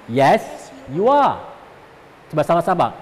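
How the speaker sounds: usual speaking voice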